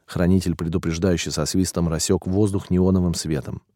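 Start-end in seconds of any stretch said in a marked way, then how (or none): none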